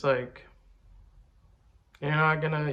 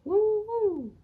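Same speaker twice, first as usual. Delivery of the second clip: high celebratory woo; hype whoop; 4 dB louder than usual. Real, not acted. Hype after boasting.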